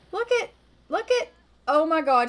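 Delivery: high, loud excited cry; real excitement. Real, not acted. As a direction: burst out as she finally nails it. She finally lands the ski run.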